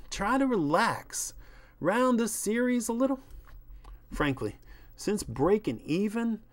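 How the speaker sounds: pleading, reasonable showbiz agent; placating agent. Acted. Voices agent soothing the star.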